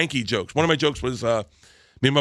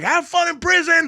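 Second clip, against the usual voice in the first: high, loud taunt; gleeful shouted parting shot; mocking yell; 4 dB louder than usual. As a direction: holler it after them as a jab. Heckler comeback yelled over laughter.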